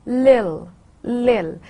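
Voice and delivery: LIL, the t of little swallowed in the throat; glottal stop. Shown as a demonstration. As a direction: drop the t of little to a catch: LIL. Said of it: "it becomes a glottal stop".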